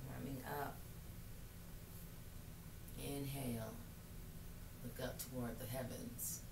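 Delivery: soft, calm meditation voice; guided meditation. Acted. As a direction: guide them gently into stillness. Leads yoga practice softly.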